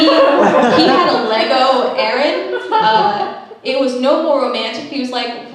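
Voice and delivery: usual speaking voice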